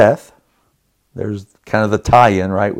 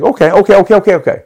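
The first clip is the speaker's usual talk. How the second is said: rapid high okays, building louder; fired up; 9 semitones higher, 3 dB louder, much faster than usual. Retold or imitated. Husband fired up, about to argue with his wife. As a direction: fire off the okays, gearing up for a fight. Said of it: "So I'm all fired up now".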